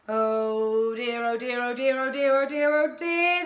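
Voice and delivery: loud, rising run of worry; nervous fretting. A narrator's reading. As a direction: fret in an unbroken, climbing chant. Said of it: "continuing to pace nervously".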